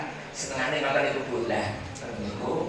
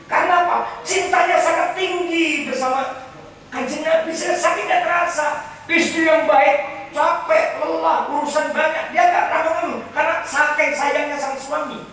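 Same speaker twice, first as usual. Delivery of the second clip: preacher extolling the loving wife; fervent preaching; 5 dB louder than usual. Acted. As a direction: cry it out with devotion. Fervent preaching at full force.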